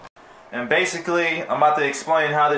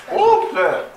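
loud; 8 semitones higher, 4 dB louder than usual. Real, not acted. Freezing in bath.

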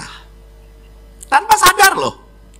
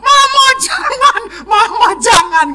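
mock child crying. Priest imitates retreat children crying for their mother, high mock sobbing. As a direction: sob like a child begging for mama.

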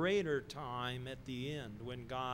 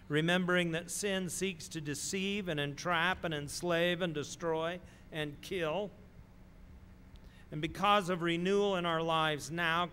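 building preacher's voice, louder; 4 semitones higher, 5 dB louder than usual. Acted. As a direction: preach it with rising force. Preaching with rising force.

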